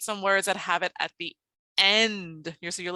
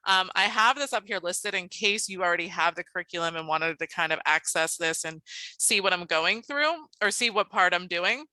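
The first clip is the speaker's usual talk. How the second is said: usual speaking voice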